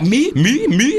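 a hint of a disbelieving player; indignant footballer. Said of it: "He's like".

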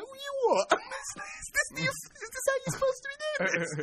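high, laughing incredulous question; mock-horrified presenter; shocked disbelief. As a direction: squeak out your disbelief. Shocked at farmer's method.

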